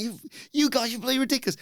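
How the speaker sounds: high exasperated boss voice; mock Pearce. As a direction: scold them high-pitched. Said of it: "Runs in to be like".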